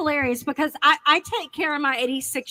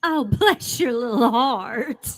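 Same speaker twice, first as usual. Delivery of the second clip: slow, drawn-out Southern condescension; syrupy Southern put-down; bad-way bless-your-heart; slower than usual. Shown as a demonstration. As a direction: stretch it out slowly with fake sympathy. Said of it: "Do it again in a bad way".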